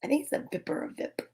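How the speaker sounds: quick hushed aside; fast whisper. Real, not acted. Reader whispers the line quickly to the viewers. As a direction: rush it in a whisper.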